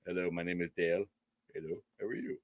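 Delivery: low, soft robotic hello; a dead-flat stranger introducing himself; low mock robot voice; mock stiff greeting. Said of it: "everything's like".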